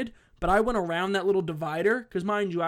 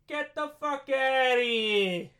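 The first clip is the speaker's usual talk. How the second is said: high, slow, drawn-out order to leave; manager kicking him out; 7 semitones higher, slower than usual. Retold or imitated. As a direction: stretch it out high like a fed-up manager. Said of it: "even the manager was like".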